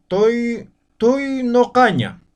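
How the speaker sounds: teacher drawing out toinocaña with an o vowel; slow pronunciation demo. Shown as a demonstration. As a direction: model toinocaña syllable by syllable. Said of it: "Aquí tenemos otro".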